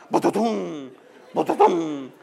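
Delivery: booming thunder made with the mouth; imitated thunder. Storm outside as he prays, imitated thunder booms. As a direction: mimic two rolling thunderclaps. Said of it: "afuera los truenos".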